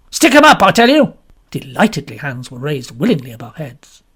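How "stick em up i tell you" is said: much higher, louder movie-gangster bark; hoarse nasal gangster. A narrator's reading. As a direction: hold them up like a cinema crook. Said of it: "A man's hoarse nasal voice".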